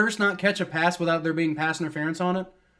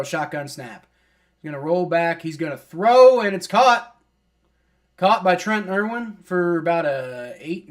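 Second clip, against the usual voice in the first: ordinary talk, nothing marked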